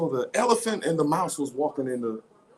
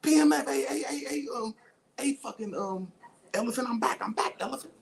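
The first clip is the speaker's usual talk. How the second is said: mouse calling back; 9 semitones higher than usual. Pretend. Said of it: "mouse jump out of something like".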